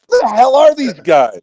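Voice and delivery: high, loud exasperated yell; yelling from the sidelines. Real, not acted. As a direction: yell at the TV in disbelief. Fan yelling at game.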